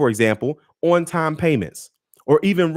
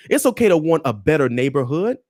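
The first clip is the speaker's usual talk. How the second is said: usual speaking voice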